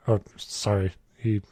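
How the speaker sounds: usual speaking voice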